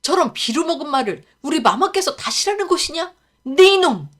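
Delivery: 네 이놈 yelled in full rage; furious outburst. Acted. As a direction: explode at the insolent boy. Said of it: "더욱 화가 나 입을 열었습니다".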